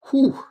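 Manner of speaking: high, loud whew; sudden high whoop of relief and excitement; excited whew. Real, not acted. Buyer overwhelmed by the new knife, excited whew.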